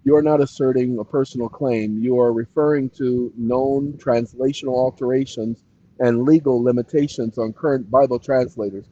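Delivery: low, even on-screen reading; reading aloud. Real, not acted. Streamer reading the chatbot's reply, reading aloud.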